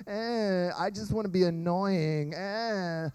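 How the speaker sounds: soft whiny mock fake cry; imitating fake cry. Mimicking a younger sibling's fake cry, mock whine. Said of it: "the fake cry where it's like".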